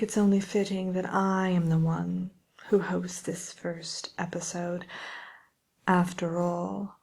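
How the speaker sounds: haughty, condescending witch, drawing words out grandly; Wicked Witch. Acted. Self-important witch hosting fan questions on her chatter show, haughty and condescending. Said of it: "pretty rude and condescending".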